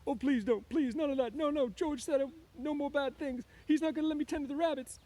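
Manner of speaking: very high, loud tearful pleading; frightened crying. A narrator's reading. Lenny afraid George will punish him, crying with fright. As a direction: wail the plea in panic. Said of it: "Lenny began to cry with fright".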